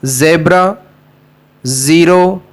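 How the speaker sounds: ordinary talk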